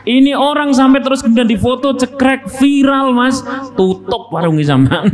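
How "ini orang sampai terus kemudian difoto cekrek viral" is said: much higher and fast; excited telling. Real, not acted. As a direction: build the drama. Excited mock story. (bersemangat, nada tinggi dan cepat)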